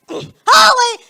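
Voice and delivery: high, loud shocked shout; shocked yelp. Retold or imitated. Guy seeing her face, loud shocked shout. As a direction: yelp it in horror.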